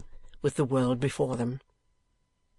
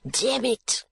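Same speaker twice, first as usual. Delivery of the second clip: high, choked exclamation; strangled, high-pitched curse; suffocating voice; 9 semitones higher than usual. A narrator's reading. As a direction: cry out as if smothered. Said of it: "he cried in a suffocating voice".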